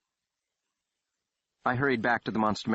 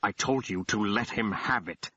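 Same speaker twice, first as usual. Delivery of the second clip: tight; 3 semitones higher than usual. Acted. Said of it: "voice tight as a constrictor knot".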